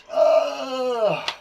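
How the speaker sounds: loud, high mock-rage groan; enraged growl. Shown as a demonstration. Teacher acting out being enraged, loud pretend angry groan. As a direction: let out a hammed-up "ugh" of fury.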